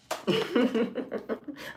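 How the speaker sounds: high, breathy villain cackle; evil laugh. Acted. Villain laugh after taunt.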